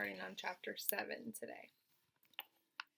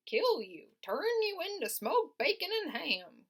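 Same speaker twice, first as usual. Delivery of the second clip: high, slow sheep voice; old sheep voice; 9 semitones higher, 7 dB louder than usual. Acted. Old sheep telling Wilbur, high and slow.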